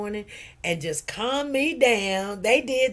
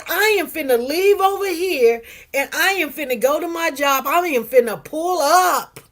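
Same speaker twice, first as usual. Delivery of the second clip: worked-up retelling; 5 semitones higher, 5 dB louder than usual. Retold or imitated. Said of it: "I said".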